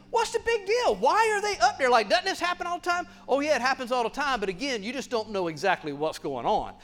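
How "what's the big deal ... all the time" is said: high, questioning mock skeptic; skeptical listener voice. Pastor voicing a doubter in the congregation, high and questioning. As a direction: play the doubting listener, pitched way up. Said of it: "you might be thinking".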